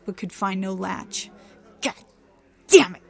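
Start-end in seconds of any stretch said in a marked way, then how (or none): 1.8-3.0 sharp, loud burst on the last words; frustrated curse. A narrator's reading. Trixie can find no latch and curses in frustration. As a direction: spit out the oath in exasperation. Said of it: "she cursed under her breath".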